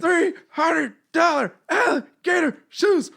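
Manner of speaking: high, loud and slow boast; Ric Flair promo. Retold or imitated. Joining in the Ric Flair quotes, slow hammed-up boast. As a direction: brag it like a wrestling heel.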